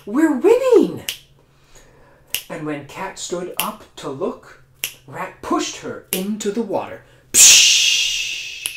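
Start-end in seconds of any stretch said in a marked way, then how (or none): 0.0-1.1 high, loud squeaky rat; rat cried. Acted. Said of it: "cried the rat". 7.3-8.7 loud breathy shove noise; a sharp pshh as she falls; push sound effect. Acted. Rat pushes cat.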